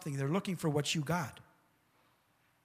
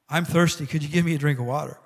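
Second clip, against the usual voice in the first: called out loud, pushing the request; loud thirsty request; loud plea for water; 8 dB louder, faster than usual. Acted. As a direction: belt out the ask for a drink. Jesus asks woman for water.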